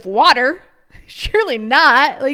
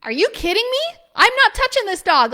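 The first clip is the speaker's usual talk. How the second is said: much higher, half-laughing disbelief; incredulous; 9 semitones higher than usual. Retold or imitated. To her husband about the abandoned dog, high incredulous. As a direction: refuse it outright, pitch jumping up. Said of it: "And I'm like".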